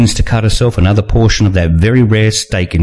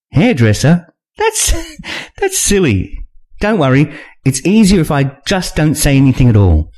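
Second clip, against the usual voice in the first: put-on higher young woman, laughing it off; Nina amused; 6 semitones higher than usual. Nina answering the narrator's cover-story plan, higher and amused. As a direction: shrug off the silly idea.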